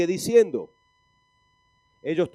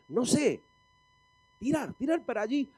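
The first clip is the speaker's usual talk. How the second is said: lightly acted, higher-pitched clueless commander; clueless general; 7 semitones higher than usual. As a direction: wave the troops off with a mock order. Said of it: "viene el general y dice".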